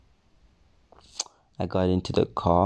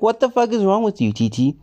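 high, fast, furious scolding; angry mom; 11 semitones higher, faster than usual. Retold or imitated. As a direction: yell it like an outraged mother. Mom yelling at son.

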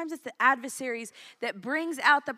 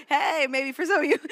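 laughing; 4 semitones higher, 8 dB louder than usual. Real, not acted. Joking about having many children.

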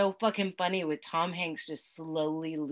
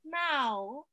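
higher, rising little meow; toddler meow; 8 semitones higher than usual. Retold or imitated. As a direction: coo a baby meow. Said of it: "He's like".

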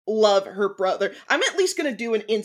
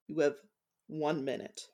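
curt warning; 5 semitones lower, 12 dB quieter than usual. Acted. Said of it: "tap a finger on the table and say".

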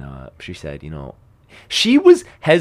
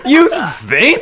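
laughing retold quote; 10 semitones higher, 4 dB louder than usual. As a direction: laugh through the words, loud and high.

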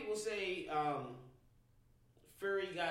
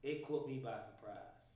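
usual speaking voice

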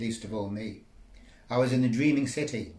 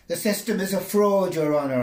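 loud protest; 8 semitones higher, 8 dB louder than usual. Acted. Protesting loudly in court.

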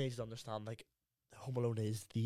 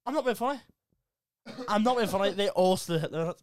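high, loud, laughing insistence; worked-up protest to a disbelieving friend; incredulous insisting; 9 semitones higher, 8 dB louder than usual. Real, not acted. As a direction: swear it's true, voice pitched right up. Insisting the unbelievable is true.

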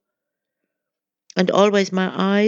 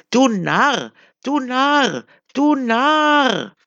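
much higher, loud mocking scream; 8 semitones higher, 4 dB louder, slower than usual. A narrator's reading. The crowd screams at the blind wanderer, mocking scream. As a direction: scream scorn at him. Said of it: "schrien sie".

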